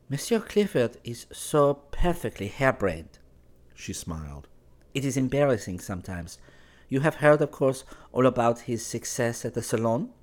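high, light woman's voice, cool and teasing; young Parisian woman. Acted. Valentine asks Clifford why he is not at Versailles, in a high, teasing woman's voice. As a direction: play her as poised and a little mocking.

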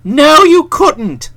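slow firm refusal. A narrator's reading. Bertie firmly refusing.

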